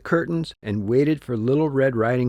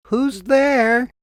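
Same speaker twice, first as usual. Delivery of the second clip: high, feeble granny voice from the wolf; wolf as weak grandmother; 10 semitones higher than usual. Acted. Wolf in bed imitates grandmother to the knocking girl, high and feeble. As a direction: fake a frail old woman calling out. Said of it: "In his best grandmother's voice, the wolf called weakly".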